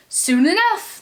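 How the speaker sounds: high, cheery crew echo. Acted. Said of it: "the crew repeated".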